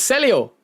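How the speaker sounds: Celio said as SELL-ee-oh; corrected pronunciation. Real, not acted. Streamer learns the viewer's real name, corrected pronunciation. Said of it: "I've been pronouncing your wrong name".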